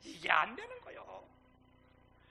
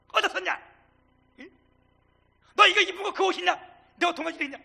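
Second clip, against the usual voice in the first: loud, raised-pitch interrogation; scolding father; 7 semitones higher, 12 dB louder than usual. Acted. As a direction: play a father grilling his son. Father's scolding, hypothetical, to returning son.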